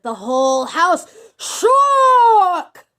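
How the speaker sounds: storyteller mimicking a mighty roar; roaring narration. A narrator's reading. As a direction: build from loud to a huge high bellow. Narrator builds Bluebeard's mighty roar.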